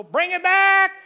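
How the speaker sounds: loud, high Pentecostal shout; booming motivational speech. Retold or imitated. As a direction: shout it out like a revival preacher. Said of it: "standing like a crazy Pentecostal".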